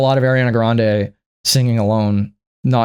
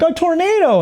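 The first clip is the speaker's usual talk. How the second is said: mock sister. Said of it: "and she's like".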